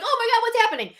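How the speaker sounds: high-pitched startled viewer reaction; mock alarm. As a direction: gasp it out like a panicked fan.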